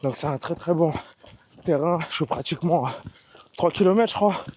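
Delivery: breathy words between strides; while running. Real, not acted. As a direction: talk while jogging, slightly winded. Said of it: "Je vais essayer de parler en courant". (en courant, un peu essoufflé)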